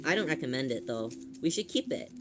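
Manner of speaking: ordinary delivery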